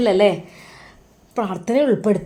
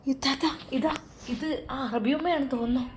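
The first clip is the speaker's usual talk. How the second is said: much softer, hushed voice; girl whispering to her sister about the caller; hushed aside; 3 semitones higher, 11 dB quieter than usual. A narrator's reading. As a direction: whisper it so the caller won't hear.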